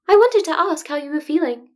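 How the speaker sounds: a careful, tactful principal; diplomatic Nezu. Acted. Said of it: "Nezu said diplomatically".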